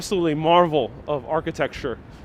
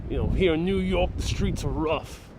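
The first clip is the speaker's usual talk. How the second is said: put-on New York accent. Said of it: "I could do the same one that kind of Dutch Moss does".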